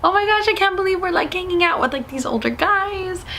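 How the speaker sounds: put-on high, gushing teen thrill; so excited. Girls in the car with older guys, giddily excited. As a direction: squeal it in a high voice, retold. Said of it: "We were so excited. We're like".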